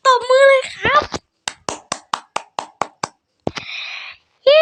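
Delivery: mock applause, hammed-up hooray; sarcastic cheer. Real, not acted. Gamer mocking his own green result with fake cheering. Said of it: "ประชดนะครับ ประชด".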